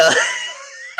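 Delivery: high, shrieking burst of laughter. Real, not acted. Storyteller realizes Brenda was forgotten, real high laughing burst.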